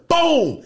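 loud boom gunshot. Retold or imitated. Imitating a gunshot.

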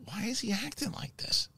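puzzled high whisper. Retold or imitated. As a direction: breathe it out as a baffled aside. Quoting a character's puzzled line.

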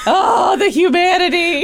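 loud, high mock dismay; mock horror. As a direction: wail it with fake horror.

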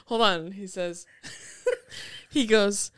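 ordinary talk

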